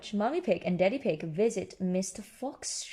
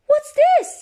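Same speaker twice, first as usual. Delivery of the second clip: much higher, louder surprised question; surprised Peppa; 13 dB louder than usual. Acted. Peppa spotting something under a bush, high surprised. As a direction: ask it in sudden wonder.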